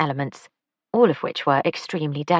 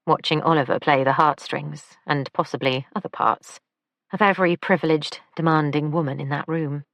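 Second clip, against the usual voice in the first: ordinary delivery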